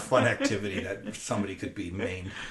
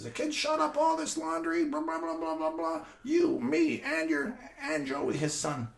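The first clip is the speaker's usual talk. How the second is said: grandfather explaining; 3 dB louder than usual. Retold or imitated. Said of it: "he says".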